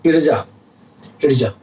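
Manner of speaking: इर्जा as a teacher repeats it; Arabic word demo. Shown as a demonstration. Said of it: "इर्जा का मतलब होता है".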